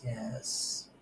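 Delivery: ordinary delivery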